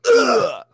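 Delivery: loud high impact grunt; forceful "ugh" of being struck; hit grunt. Shown as a demonstration. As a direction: try out a gut-punch grunt. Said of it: "make a sound when you hit".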